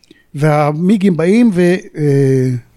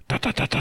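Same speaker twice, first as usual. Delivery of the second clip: soft unvoiced rat-a-tat of rockets; imitated gunfire; 4 semitones lower, 11 dB quieter than usual. Veteran mimics rocket fire in his story, soft whispered rat-a-tat. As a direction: tap out the gunfire under your breath.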